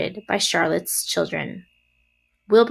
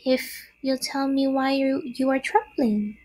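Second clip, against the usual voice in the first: higher, small spider voice; little spider; 6 semitones higher than usual. A narrator's reading. First spider questions trembling Wilbur, small voice. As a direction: ask in a little high voice. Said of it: "replied the first little spider".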